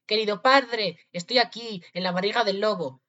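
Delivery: high, loud call for attention; shouting. A narrator's reading. Tom Thumb to his father from the wolf, shouting. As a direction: call out from inside the belly. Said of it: "gritó".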